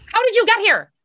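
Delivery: exasperated outburst. Real, not acted. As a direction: throw up your hands and demand it. Exasperated repeat of question.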